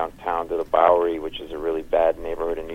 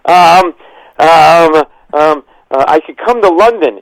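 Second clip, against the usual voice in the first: high, loud stammering panic; mock-nervous stammer, high and loud; 11 semitones higher, 13 dB louder, slower than usual. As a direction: stammer like a starstruck fan. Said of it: "I literally sounded like this".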